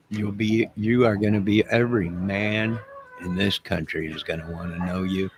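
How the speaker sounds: ordinary talk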